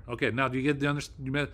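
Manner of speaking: normal talking voice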